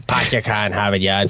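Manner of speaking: quick mock Boston accent with dropped R's. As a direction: toss it off in stock Boston speech. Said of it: "trashier Boston accent".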